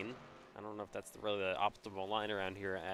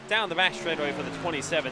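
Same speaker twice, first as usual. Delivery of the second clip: raised, louder race call; excited call; 5 semitones higher, 7 dB louder than usual. Real, not acted. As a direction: call it out with energy. Calling cars down the straightaway.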